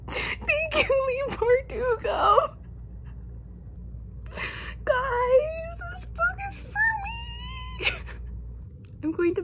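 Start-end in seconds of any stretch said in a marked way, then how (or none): 0.1-2.6 high, tearful fangirl voice; overwhelmed joyful weeping; happy crying. Real, not acted. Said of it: "I'm a wreck". 4.9-7.9 very high, slow crying wail; overwhelmed joyful cry; tearful squeal. Real, not acted. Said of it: "I'm a wreck".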